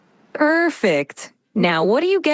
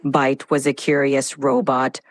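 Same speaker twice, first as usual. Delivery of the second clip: lower, dramatic robot voice; robotic voice; 7 semitones lower than usual. Acted. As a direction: metallic cyborg voice. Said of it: "can you do this in a robotic voice now".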